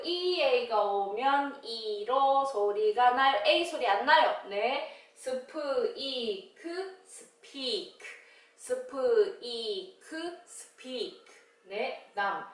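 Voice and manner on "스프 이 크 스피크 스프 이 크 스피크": 스피크 sounded out slowly and softly; quiet drawn-out sounding of 스피크; slow, soft blending. Shown as a demonstration. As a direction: ease the sounds together gently. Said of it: "blending speak slowly".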